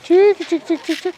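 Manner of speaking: high loud sing-song chicken call. Real, not acted. Calling chickens to come.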